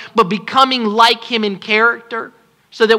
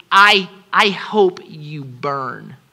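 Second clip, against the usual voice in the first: slow, whispered mock of a cold preacher; 6 semitones lower, much slower than usual. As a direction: hiss it slowly, sneering under the breath.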